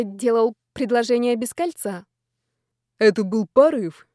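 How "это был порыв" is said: quick, emphatic interjection; cutting in. A narrator's reading. Boss butts into fiancée's explanation, cutting in. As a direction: break in to explain yourself. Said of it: "вклинился шеф".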